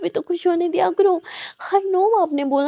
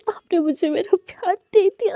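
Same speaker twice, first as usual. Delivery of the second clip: plain reading voice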